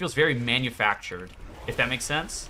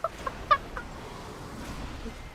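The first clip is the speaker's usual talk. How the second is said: high wheezing giggle; breathless laugh; 8 dB quieter than usual. Real, not acted. Streamer cracking up at his own joke, breathless laugh. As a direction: let a silent wheeze of a laugh out.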